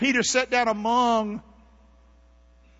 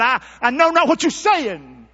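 loud, raised denial; Peter's denial; 4 semitones higher, 7 dB louder than usual. Acted. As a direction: push the lie out hard. Peter denies knowing Jesus.

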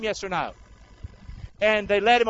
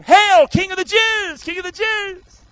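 loud, high, slow sneering hail; mock the king with a grand sneer; mocking soldiers; 10 semitones higher, 6 dB louder than usual. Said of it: "Mocked him".

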